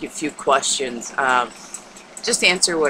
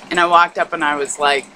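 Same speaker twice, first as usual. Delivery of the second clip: usual speaking voice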